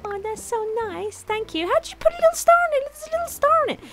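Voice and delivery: very high, delighted squeal of thanks; delighted cooing. Real, not acted. Vlogger touched by a little star on a gift, delighted cooing. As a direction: coo over it in a high, thrilled voice.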